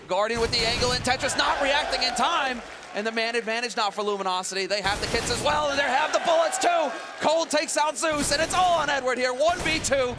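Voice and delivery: rising to a shouted clutch call; building hype, high and loud; esports caster. Real, not acted. Clutch round called live.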